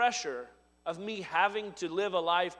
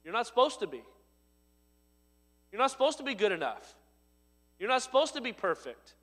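higher, stressing supposed; building sermon emphasis; 5 semitones higher, faster than usual. Acted. Preacher pressing the point, stress on supposed. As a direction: drive it home, rising. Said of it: "preaching building".

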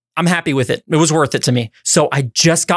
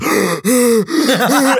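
imitated out-of-breath panting; exaggerated winded gasps; mouth-breathing gasps; 5 semitones higher than usual. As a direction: gasp through the mouth like a winded runner. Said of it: "it was just all mouth".

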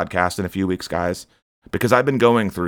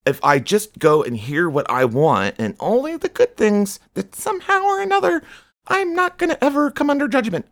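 high, whiny parody of a self-assured churchgoer; mocking churchgoer; 13 semitones higher than usual. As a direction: mimic a smug believer, much higher. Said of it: "thinking like".

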